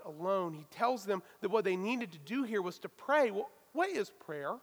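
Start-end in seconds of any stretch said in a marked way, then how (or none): none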